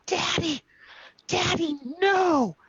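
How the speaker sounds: put-on very high-pitched child's plea; upset little daughter. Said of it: "And she starts to cry".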